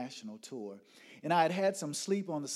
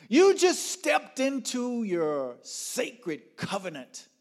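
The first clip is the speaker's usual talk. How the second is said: high, loud, slow declaration; stretched-out, ringing pronouncement; grand proclamation; 10 semitones higher, 9 dB louder, slower than usual. Retold or imitated. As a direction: proclaim it solemnly at full voice. Booming voice announces covenant.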